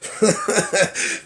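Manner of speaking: short high burst of laughter; embarrassed laugh. Real, not acted. Vlogger laughing at her messy hair, short embarrassed laugh. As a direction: laugh it off at yourself.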